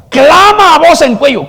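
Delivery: high, loud cry; preacher crying out the verse; ringing shout. Acted. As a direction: shout it like a trumpet blast. Repeats verse, louder and higher.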